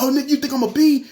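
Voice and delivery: high-pitched mock outrage; indignant challenge. Robber challenging storyteller before rushing him, indignant. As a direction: imitate an offended man squaring up. Retold robber squaring up to fight.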